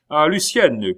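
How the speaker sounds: a sharp cry of a name; cried out. A narrator's reading. Valet crying out to Lucienne, loud. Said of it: "cria-t-il".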